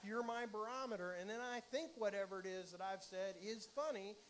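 normal talking voice

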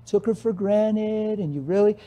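counselor voice. Acted. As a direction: stretch the words out like an indulgent counselor. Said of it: "the counselor could sit and say".

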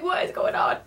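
whispered. Real, not acted. Flustered whisper.

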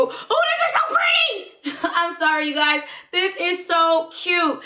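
high, giggly squeal of delight; overexcited giggling. Real, not acted. Apologizing to viewers while gushing over the pink lipstick, high and giggly. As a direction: giggle and gush, too thrilled to talk straight. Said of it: "That's how excited I am".

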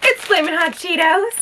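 high, loud excited announcement; overjoyed, high and loud; thrilled. Real, not acted. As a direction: squeal the discovery out loud. Discovers favourite snack.

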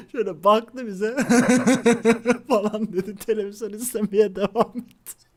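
giggling as he describes dad's look; laughing. Real, not acted. Laughing while retelling dad. (gülerek anlatıyor)

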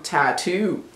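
"tattoo" as spelled, both t's sounded; phonetic pronunciation. Shown as a demonstration. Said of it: "looks the way it should sound".

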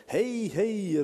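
high, loud call across the church. Retold or imitated. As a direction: hail the preacher from the doors. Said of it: "ropar hon med rollatorn". (ropande, högt och ljust)

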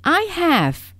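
high, loud protesting cry. Acted. Little rabbit cries out against the taunt. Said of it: "cried the little rabbit".

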